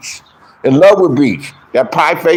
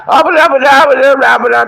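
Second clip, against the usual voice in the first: mocking babble; 8 semitones higher, 4 dB louder than usual. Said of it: "she was like".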